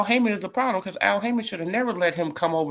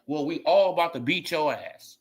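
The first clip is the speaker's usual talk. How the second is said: ordinary delivery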